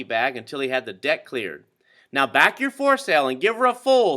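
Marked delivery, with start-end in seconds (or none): 2.1-4.2 higher, louder order; scream. A narrator's reading. Owner screaming sailing orders, higher louder shout. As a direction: scream the command across the water. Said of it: "screamed the owner of the Wasp".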